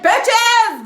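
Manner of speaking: high, loud showy call-out; mock-diva holler; mock grand entrance. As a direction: announce yourself like a hammed-up star arriving. Mock grand entrance onto the scene.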